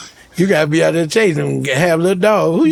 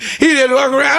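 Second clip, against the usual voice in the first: ordinary talk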